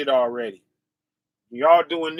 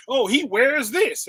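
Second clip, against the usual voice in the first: slow, higher mocking complaint; mock fault-finding; 6 semitones higher than usual. Preacher mimicking people with pet peeves, mock complaint. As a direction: draw it out like a petty critic. Voices petty fault-finders.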